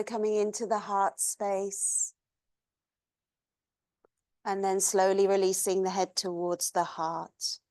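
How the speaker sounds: soft, even voice with long pauses; guided meditation. Acted. Yoga teacher leads class through long exhales, guided meditation.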